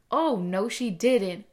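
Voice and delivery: loud, sassy mock outrage; sassy attitude. Imitating husband dictating a text, loud with attitude. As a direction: pile on the attitude, loudly. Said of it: "he'll just add the attitude with it".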